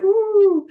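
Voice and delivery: high, loud buzzing ooh; wired brain ooh. Retold or imitated. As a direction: make the sound of a brain that won't switch off.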